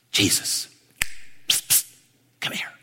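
hushed secretive whisper, unvoiced; stage whisper. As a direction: whisper like a secret night visitor. Nicodemus calls secretly at night.

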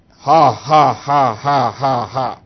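low, measured fake "ha ha"; imitated hollow laugh in even beats; put-on dry laugh. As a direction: pretend to laugh, finding nothing funny. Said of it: "It was just a put on thing".

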